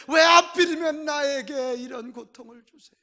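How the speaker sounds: loud, high cry of complaint to God; shouted why-me lament; anguished protest. Acted. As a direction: act out a suffering parent protesting loudly. Parent crying out complaint to God.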